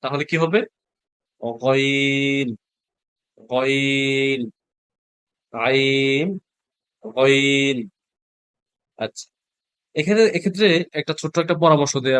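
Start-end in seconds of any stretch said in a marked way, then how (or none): 1.6-7.8 slow stretched গইন with a throaty gh; drawn-out Arabic ghain. Shown as a demonstration. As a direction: hold গইন long, gargled from the throat. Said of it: "আমি একটা নোক্তা দেই". (গলা থেকে টেনে গইন)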